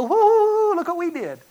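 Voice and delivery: high, loud celebratory whoop, mocking; gleeful whooping. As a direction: whoop it up like a gloating reveler. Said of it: "have fun with it and go".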